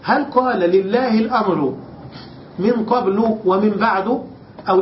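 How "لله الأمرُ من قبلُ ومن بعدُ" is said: قبل and بعد as qablu, ba'du; fixed damma endings. Shown as a demonstration. Teacher offering students the first reading, قبل and بعد ending in u. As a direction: end قبل and بعد on short u. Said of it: "فقد بناهما".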